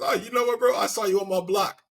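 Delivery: high mock tough-guy voice; mock the ram picking a fight. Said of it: "I'm like".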